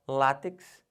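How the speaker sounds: "látex" as LÁ-tex, first syllable loud; stress on LÁ. Shown as a demonstration. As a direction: punch LÁ in "látex". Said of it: "O certo é látex". ("látex" com força no LÁ)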